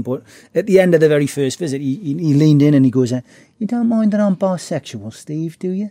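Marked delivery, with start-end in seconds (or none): 3.6-5.9 soft, higher imitation of a gentle gangster; lightly voiced, leaning in to confide; quietly spoken Ron. Ron leaning in to ask his visitor, softly imitated. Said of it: "he was very quietly spoken".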